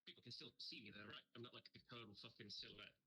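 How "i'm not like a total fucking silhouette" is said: ordinary talk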